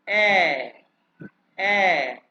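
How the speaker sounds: ए as a long German ä, loud; German umlaut A. Shown as a demonstration. Teacher asking for close listening, ए as German ä. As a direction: hold ए out firmly as the umlauted A. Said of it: "दोबारा pronounce करके बताऊंगा".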